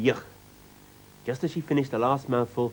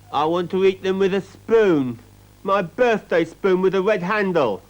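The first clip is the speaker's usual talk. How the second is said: put-on demanding little girl; 6 semitones higher, 6 dB louder than usual. A narrator's reading. Child demanding her birthday spoon.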